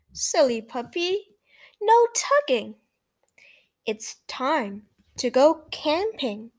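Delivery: lively read-aloud for small listeners; reading to children. A narrator's reading. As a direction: read it brightly to little kids. Reads picture book to children.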